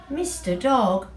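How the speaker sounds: plain reading voice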